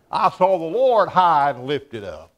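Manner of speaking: awed proclamation. Acted. Quoting Isaiah's vision.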